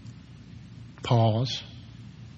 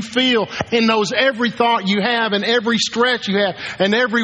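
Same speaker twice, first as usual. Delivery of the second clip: full-force preaching; 8 semitones higher than usual. Acted. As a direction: thunder the verses out like a preacher at full cry.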